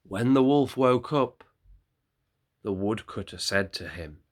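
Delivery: usual speaking voice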